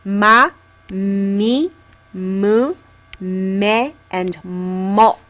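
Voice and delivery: ma, mi, mu, me, mo said one by one; m row read out. Shown as a demonstration. Teacher reading the m row aloud for students. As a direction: model each syllable of the m row clearly. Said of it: "all of the ones underneath it also start with an M sound".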